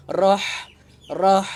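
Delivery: "Rah" with a loud harsh breathy h; spicy ha. Shown as a demonstration. Teacher makes the h burn like chili. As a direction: push a hot hiss through "Rah". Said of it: "seumpama kita makan cabai".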